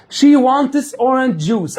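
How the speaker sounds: She wants orange juice drawn out very slowly; slow teacher demo; slow model sentence. Shown as a demonstration. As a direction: say it slowly for the class to repeat. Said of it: "عايزه ايه".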